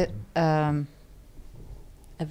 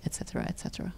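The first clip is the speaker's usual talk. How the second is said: whisper; 13 dB quieter, much faster than usual. Real, not acted. Trails into whisper.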